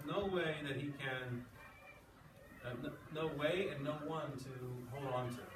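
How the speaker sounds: normal talking voice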